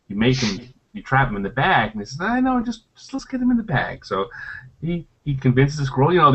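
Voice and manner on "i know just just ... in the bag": brushing off. Retold or imitated. Player to game master about trapping a squirrel, dismissive. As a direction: imitate a player waving away a warning.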